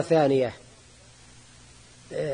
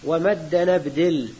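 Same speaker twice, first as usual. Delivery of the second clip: verse recitation; 3 semitones lower, much slower than usual. Shown as a demonstration. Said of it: "وهذا هو المراد بقوله".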